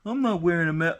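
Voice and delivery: mimicking the people who won't wear a mask; mocking anti-masker. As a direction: sneer out their selfish excuse, mildly hammed. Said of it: "people that are like".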